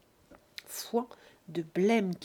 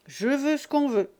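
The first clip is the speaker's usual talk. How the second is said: careless agreement; 6 semitones higher, 7 dB louder than usual. Acted. Drunk son agrees.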